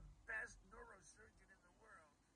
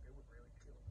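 usual speaking voice

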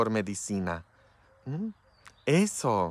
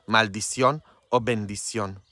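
ordinary talk, nothing marked

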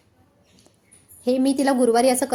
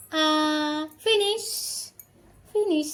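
cheerful praise; 4 semitones higher than usual. Real, not acted. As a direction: celebrate like she did something great. Praising toddler finishing medicine.

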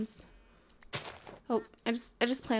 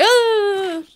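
startled scream; 8 semitones higher, 12 dB louder than usual. Real, not acted. Scream at sudden appearance.

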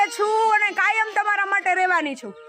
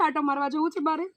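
ordinary talk

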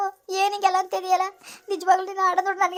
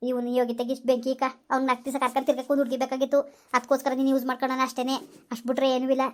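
stern friend; 7 semitones lower than usual. Acted. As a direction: lower voiced friend, firm advice. Friend scolding, lower. (ಗಟ್ಟಿಯಾದ ಗೆಳತಿಯ ಬುದ್ಧಿವಾದ)

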